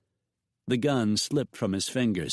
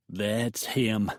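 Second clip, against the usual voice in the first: ordinary delivery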